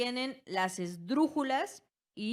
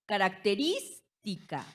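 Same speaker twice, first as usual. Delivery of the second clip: "Característica" con RÍS marcada y una pausa después; énfasis en la sílaba tónica. Shown as a demonstration. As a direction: alarga RÍS en "Característica" y sepárala. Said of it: "pertenecen a las esdrújulas". ("Característica" como ejemplo: caracte-RÍS-tica)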